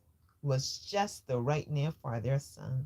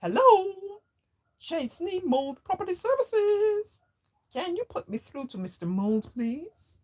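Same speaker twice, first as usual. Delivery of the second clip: much higher, louder bright phone voice; happy busy voice; 7 dB louder than usual. A narrator's reading. Father answering business call in happy, busy voice. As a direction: switch on the cheery business caller. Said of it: "his happy, busy voice".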